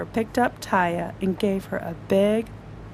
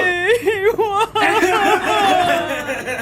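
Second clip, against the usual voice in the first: high giggle breaking through; laughing at the story; long giggling laugh; 10 semitones higher, 6 dB louder than usual. Real, not acted. Laugh after child line.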